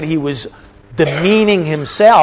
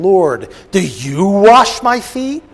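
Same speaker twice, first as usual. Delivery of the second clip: higher, louder and slow, leaning on "you"; emphatic astonished question; 4 semitones higher, 6 dB louder, slower than usual. Shown as a demonstration. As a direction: read it as Peter in disbelief, stressing "you". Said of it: "let me read you in the way verse six would be spoken".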